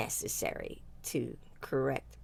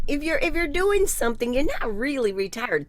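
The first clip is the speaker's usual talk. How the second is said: much higher and louder, cutting in to correct her friend; emphatic contradiction; 9 semitones higher, 10 dB louder than usual. Real, not acted. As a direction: insist firmly, louder and higher.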